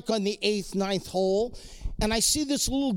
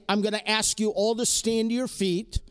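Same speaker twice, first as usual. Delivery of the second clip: ordinary delivery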